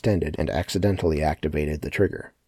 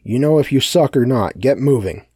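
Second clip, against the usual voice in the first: loud, raised, impatient order; higher and louder, ordering them to move; commanding; 6 semitones higher, 7 dB louder than usual. A narrator's reading. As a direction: hurry them along with a forceful command. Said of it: "Sebastian commanded".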